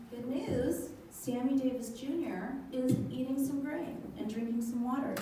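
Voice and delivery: usual speaking voice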